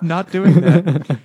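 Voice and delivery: mock horrified no; mock refusal. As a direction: drag it out, hammed-up.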